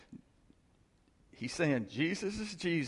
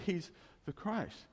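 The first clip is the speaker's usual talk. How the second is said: normal talking voice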